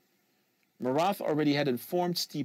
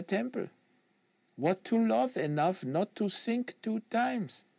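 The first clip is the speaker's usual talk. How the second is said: put-on French Canadian accent; 8 semitones higher than usual. Quebecois agent lecturing the American on fanatic, French Canadian accent.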